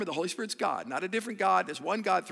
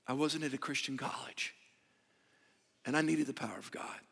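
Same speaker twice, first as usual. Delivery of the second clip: soft, low, earnest near-whisper; hushed preaching voice; hushed confession; 6 semitones lower, 5 dB quieter than usual. Acted. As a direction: drop it down to almost a whisper. Intimate confession to congregation.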